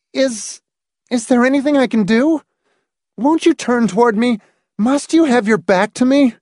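high, halting start, then quickening questions; distressed, high-pitched wife; anxious pleading. A narrator's reading. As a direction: plead with him in a high, worried voice. Frightened wife pleads with husband.

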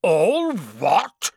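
loud, high giant's bellow; thunderous outburst. A narrator's reading. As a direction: thunder it back in outrage. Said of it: "Hagrid thundered".

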